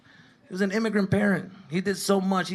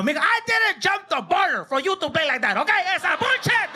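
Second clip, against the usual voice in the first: much higher, loud outraged dad yell; dad shouting; 13 semitones higher, 4 dB louder than usual. Retold or imitated. Dad yelling at his son in front of people.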